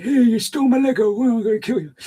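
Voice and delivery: high, loud hammed-up gangster; mock mafia boss. Streamer imitates Don Corleone threatening over Lego, hammed up. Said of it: "Don Corleone, be like".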